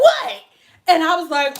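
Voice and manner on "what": high, loud startled what; a blurted what. Retold or imitated. The California client shouting in shock, loud and high. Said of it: "She was like".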